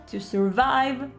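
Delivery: normal talking voice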